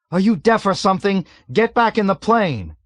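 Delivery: high, loud exasperated shouts; exasperated shouting. A narrator's reading. Frantic pilot shouts at oblivious navigator. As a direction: shout him back in disbelief.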